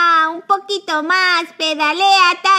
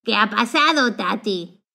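voz grave de personaje; 9 semitones lower, 3 dB quieter than usual. Acted. Toy character asks Tati.

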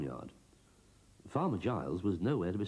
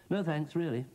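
high broomstick voice; 10 semitones higher than usual. Acted. Broomstick's voice in story.

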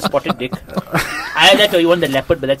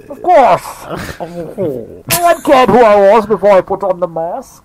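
slow, raised masked-villain voice; Bane; 4 semitones higher, slower than usual. Acted. Quoting a masked villain to his friends, Bane voice. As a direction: declaim it slowly like a masked villain. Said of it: "That's Bane".

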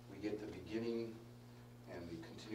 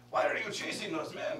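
raised, louder mock French accent; horrible French accent; 6 semitones higher, 8 dB louder than usual. Pastor voicing a yell at a running man, hammed-up French accent. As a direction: call it out in a bad French accent. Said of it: "That's, that's my horrible French accent".

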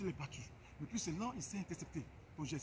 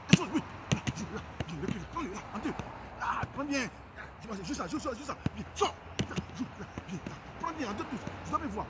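sharp, raised coaching calls, higher and louder; loud drill calls; 5 semitones higher, 7 dB louder than usual. Real, not acted. Coach calling commands to his player during the drill, loud and urgent. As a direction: bark quick commands at a player mid-drill.

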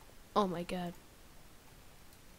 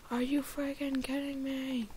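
usual speaking voice

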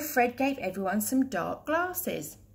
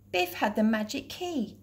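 ordinary delivery, nothing marked

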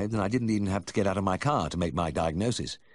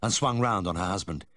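plain reading voice

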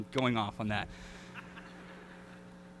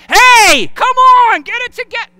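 high, loud yell from the stands; screaming parent; 13 semitones higher, 10 dB louder than usual. Retold or imitated. Parent in the bleachers screaming at a struggling kid, loud and high. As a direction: holler at the kid like an angry sports parent. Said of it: "do you scream at 'em from the stands".

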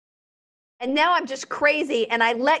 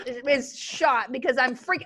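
usual speaking voice

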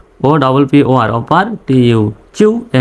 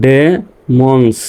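slow "demons", split into ডে and মন্স; teacher's slow sounding-out; syllable by syllable; much slower than usual. Shown as a demonstration. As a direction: leave a gap inside "demons". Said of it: "পর্যন্তই উচ্চারণ করব".

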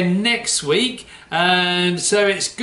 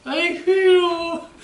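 much higher, slow, squeaky puppet voice; squeaky puppet punchline; 12 semitones higher, much slower than usual. Acted.

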